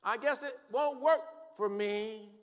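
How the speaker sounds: slow, loud, high mock lament; exaggerated woe-is-me wail; mock defeated complaint. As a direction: drag out a hammed-up self-pity.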